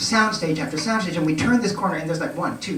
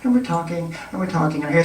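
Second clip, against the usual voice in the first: usual speaking voice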